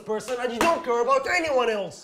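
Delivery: angry shouted accusation; shouting angrily. Acted. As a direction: yell it at the careless roommate. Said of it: "You're raising your voice".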